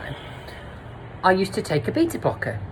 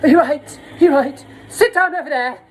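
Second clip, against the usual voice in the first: mock high camp voice, much higher and louder; a hammed-up flustered host; 9 semitones higher, 5 dB louder than usual. Retelling a high host offering tea, camp voice. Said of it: "he was like".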